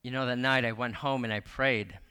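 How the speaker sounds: ordinary talk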